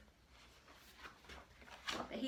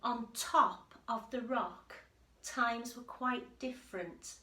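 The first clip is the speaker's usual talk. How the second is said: lively read-aloud voice, a little louder; story narration; 6 dB louder, slower than usual. A narrator's reading. Teacher reading the picture book to the class, lively storytelling. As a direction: read it to the children with bounce.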